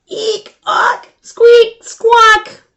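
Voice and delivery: high, loud squeaks and squawks; shrill little squeak sounds; squeaky trike noises. Acted.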